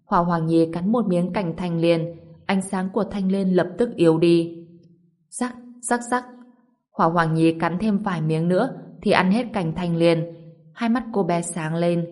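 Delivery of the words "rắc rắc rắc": high, soft crunch noises; imitated crunching. A narrator's reading. Girl bites the lotus, high soft crunching noises. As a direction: voice the bites as little cracks.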